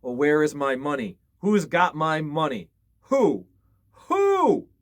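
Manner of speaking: loud ghostly cry rising to a high wail; dead woman wailing for her coins. A narrator's reading. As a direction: call for the money like a lost spirit. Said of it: "the ghost of the dead woman cried".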